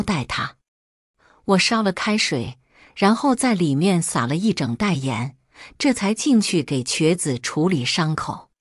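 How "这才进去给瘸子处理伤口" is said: ordinary delivery, nothing marked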